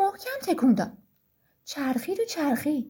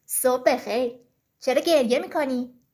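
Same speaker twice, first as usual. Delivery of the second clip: low-voiced story fox asking; fox character; 6 semitones lower than usual. A narrator's reading. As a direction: play the fox low, with a questioning greeting. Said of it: "روباه از راه رسید و گفت".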